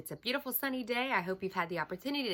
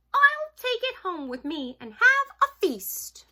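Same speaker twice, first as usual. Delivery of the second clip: high, loud storybook exclamation; excited cry; 14 semitones higher, 10 dB louder than usual. Acted. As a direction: crow with delight as a hungry mouse. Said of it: "he cried".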